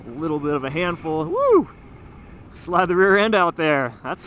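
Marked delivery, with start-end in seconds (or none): 1.2-1.7 high, loud whoop of joy; excited whoop. Real, not acted. Bike slides on dirt hill. 2.5-3.9 loud thrilled call; excited. Real, not acted. Thrilled mid-slide.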